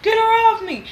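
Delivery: high mock scream. Imitating Africa screaming in a headlock, high-pitched. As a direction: shriek it like she's pinned. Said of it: "she was screaming".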